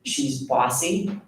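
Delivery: ordinary talk, nothing marked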